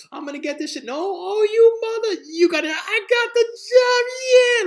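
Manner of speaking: very high squeal building to a shout; ecstatic outburst. Acted. Applicant realizing he got the job, ecstatic screaming. Roller coaster reaction to job.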